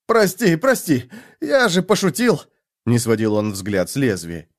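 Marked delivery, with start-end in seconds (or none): 0.1-2.4 high, panicky pleading; desperate yelled apology. A narrator's reading. Bound man apologises as she comes near with knife, panicked. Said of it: "он заорал".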